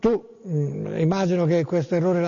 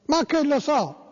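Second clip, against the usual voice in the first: mock listener quote; 7 semitones higher, slower than usual. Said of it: "dice Lucas da Monte Carlo".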